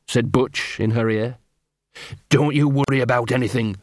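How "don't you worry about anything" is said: usual speaking voice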